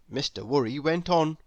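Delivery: usual speaking voice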